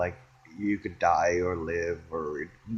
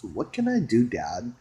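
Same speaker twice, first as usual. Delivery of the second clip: ordinary talk, nothing marked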